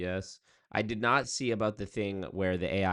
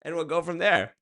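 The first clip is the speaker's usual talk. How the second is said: chirpy AI operator; 10 semitones higher, 7 dB louder than usual. Pretend. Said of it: "AI on the other end".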